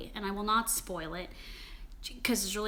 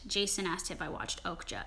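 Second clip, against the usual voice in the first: whisper; 4 semitones lower, 7 dB quieter than usual. Real, not acted. Reading a viewer question aside.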